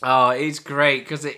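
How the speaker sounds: normal talking voice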